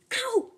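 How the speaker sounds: sharp high yelp; high ow of pain. Acted. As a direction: yelp as if zapped. Tail zapped by laser.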